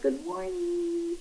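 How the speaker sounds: high, slow, gentle morning greeting; drawn-out, lilting hello to a waking child; sing-song good morning. Retold or imitated. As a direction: coo it to a well-rested baby. Greeting daughter after good sleep.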